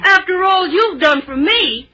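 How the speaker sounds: mocking, slow and scornful. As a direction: throw his words back at him. Scornful reply to the manager.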